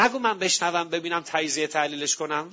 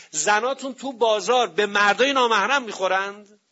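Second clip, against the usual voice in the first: loud rebuke to the men, louder as it goes; retold shout; 4 semitones higher, 5 dB louder than usual. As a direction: thunder it at the crowd as the Imam. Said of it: "فریاد کشید سر مردم". (فریاد امیرالمومنین بر سر مردم، پرشور و بلند)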